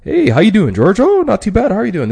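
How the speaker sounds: high, loud chirpy small talk; sing-song friendly greeting. Shown as a demonstration. Greeting George in small talk, high and chirpy. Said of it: "enunciate at the very end of every sentence".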